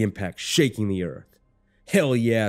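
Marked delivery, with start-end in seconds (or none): 1.9-2.5 raised, louder whoop of triumph; triumphant battlefield shout; screamed cheer. A narrator's reading. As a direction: cheer it out loud. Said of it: "somebody screams to my right".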